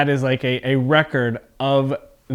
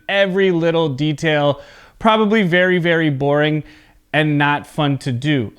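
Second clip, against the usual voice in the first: ordinary delivery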